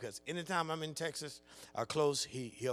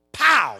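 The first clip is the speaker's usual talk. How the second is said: sudden louder "pow"; imitated slap; 10 semitones higher, 17 dB louder than usual. Preacher acts out wife slapping daughter's thigh, imitated slap. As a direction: punch out the sound of a smack.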